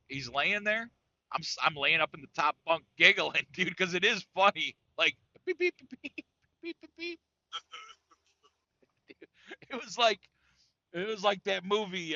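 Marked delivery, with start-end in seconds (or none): 5.0-7.2 high electronic beeps, fading at the end; high mock beep; imitated computer beeping. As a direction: mimic the beeping while giggling. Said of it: "the computer won't stop beeping".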